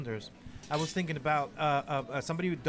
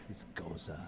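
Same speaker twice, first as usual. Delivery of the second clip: stickler correcting; 11 semitones lower, 13 dB quieter than usual. Retold or imitated. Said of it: "he always goes".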